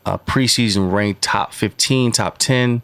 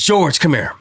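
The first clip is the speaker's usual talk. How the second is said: higher-pitched imitated summons; coach calling out; 8 semitones higher than usual. Coach summoning a player off the field, calling out. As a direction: call the player over like a coach.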